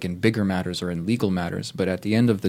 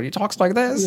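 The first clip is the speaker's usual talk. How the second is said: a much higher, playful warm-up voice; mock Bane voice; 11 semitones higher than usual. Asked to do the Bane accent, jokingly tries a higher voice. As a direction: squeak it out as a joke before the impression. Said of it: "Can you do it in the accent?".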